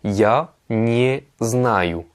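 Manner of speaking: я не знаю said very slowly and carefully; teacher's slow clear demo; slow model phrase. Shown as a demonstration. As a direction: spell out я не знаю syllable by syllable for learners. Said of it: "vous allez dire".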